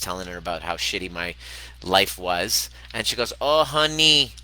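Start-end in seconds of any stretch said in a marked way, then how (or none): 3.4-4.3 put-on high, loud Venezuelan-accented friend. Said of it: "Venezuelan accent".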